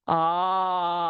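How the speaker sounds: big released ah sound; loud open ah. Shown as a demonstration. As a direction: let out a full ah. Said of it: "make a sound like, "Ah".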